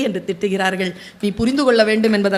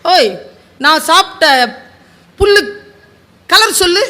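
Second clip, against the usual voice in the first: high, louder teasing challenge; taunting donkey; 4 semitones higher, 5 dB louder than usual. Acted. Donkey taunts the tiger to name the grass colour, mock challenge. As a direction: poke at the tiger, daring him.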